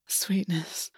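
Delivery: a soft, breathless whisper. A narrator's reading. August murmuring to Gwen, a tender whisper. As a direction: breathe the endearment, no voice. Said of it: "August whispered".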